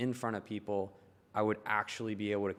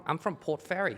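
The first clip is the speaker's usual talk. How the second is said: higher put-on Australian accent; a proud, cheeky Australian accent; fake Australian accent; 8 semitones higher, 4 dB louder than usual. Shown as a demonstration. Answering friends in a mock Australian accent, higher. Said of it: "in an Australian accent".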